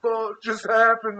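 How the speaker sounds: high, slow, dazed question; retold stunned disbelief. Son after being smacked in the face, mock stunned disbelief. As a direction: stretch it out, appalled.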